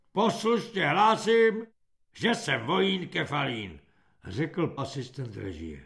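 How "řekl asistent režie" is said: ordinary delivery, nothing marked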